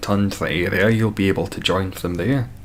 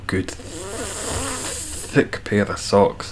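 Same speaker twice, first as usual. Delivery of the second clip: swooning delight; 11 semitones higher, much slower than usual. Real, not acted. Swooning over a good pair of socks.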